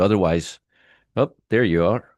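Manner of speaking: normal talking voice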